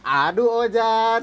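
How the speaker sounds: imitating an old comedy sketch line; sketch comedy catchphrase. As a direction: ham up a sitcom sidekick's lament, drawn out. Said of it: "yang gitu-gitu tuh".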